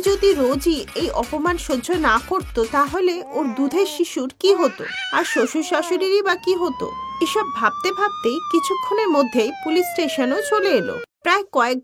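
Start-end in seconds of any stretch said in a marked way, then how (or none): none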